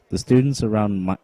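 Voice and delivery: ordinary delivery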